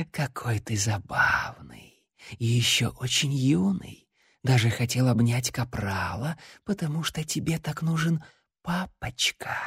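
slow soft eerie whisper; eldritch horror. Acted. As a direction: tempt him like living darkness. The shadow keeps speaking.

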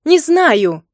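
loud, high angry snap; angry bark. A narrator's reading. Wife answering husband's accusation, angry bark. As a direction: bark it out in temper. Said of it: "рявкнула я зло".

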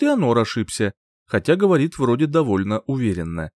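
usual speaking voice

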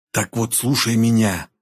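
ordinary delivery

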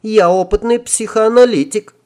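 put-on high squeaky girl voice; self-important squeak. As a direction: pipe it up, full of importance. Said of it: "важно пискнула она".

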